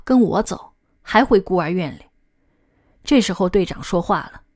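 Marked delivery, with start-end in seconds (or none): none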